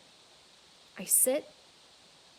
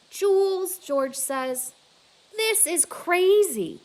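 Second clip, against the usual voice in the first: amazed disbelief; 9 semitones higher, 6 dB louder, slower than usual. Acted. George bewildered.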